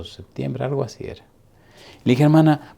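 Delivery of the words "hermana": loud call of hermana. Retold or imitated. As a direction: call her name firmly, pastor to sister. Pastor calls out to sister.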